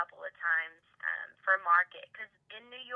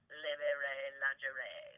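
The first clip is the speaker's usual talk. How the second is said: Livy Ray Lingerie in a playful mock French accent; laughing fake French accent; 6 semitones lower, 6 dB quieter, slower than usual. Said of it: "saying it, like, with an accent".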